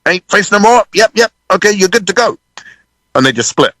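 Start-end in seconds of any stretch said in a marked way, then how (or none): 0.0-2.4 businesslike runner, pitched well up. Retold or imitated. BBC runner with clipboard dismissing the band, brisk call. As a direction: imitate a crew member sending a band off. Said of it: "a B B C runner from the T V show comes down with a clipboard".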